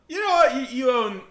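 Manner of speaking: loud, high-pitched yell; mock outrage. Streamer teasing annoyed British viewers, mock-outraged shout. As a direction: burst out in playful indignation.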